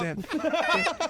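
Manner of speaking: high and slow, drawing out timestamp; joking cue to the editor, high and slow; mock high drawn-out call. Shown as a demonstration. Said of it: "Sorry, Teagues".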